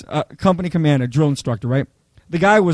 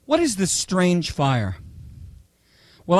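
ordinary talk

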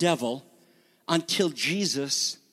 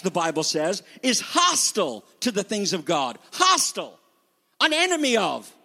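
higher, emphatic preacher's delivery; forceful preaching; 5 semitones higher than usual. Real, not acted. Preacher warning the congregation about the flesh, forceful preaching. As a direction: drive each key word home.

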